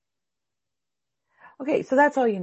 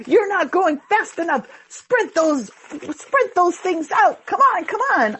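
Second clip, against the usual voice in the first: high, loud mock drill sergeant barking orders; pushy coach; 9 semitones higher, 6 dB louder than usual. Teacher mocking a pushy coach yelling at students. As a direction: yell like a pushy trainer cracking the whip. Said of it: "If I come in here and I go".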